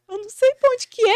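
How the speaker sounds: voz aguda e alta de quem se perdeu; perdida, imitada. Retold or imitated. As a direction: encena um desamparo exagerado, bem agudo. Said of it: "eu meio perdida". (perdida e aflita, em tom de imitação)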